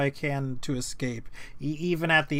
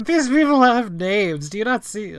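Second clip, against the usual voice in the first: high, loud exasperation; incredulous, half laughing; exasperated; 7 semitones higher, 8 dB louder than usual. Real, not acted. GM exasperated at players.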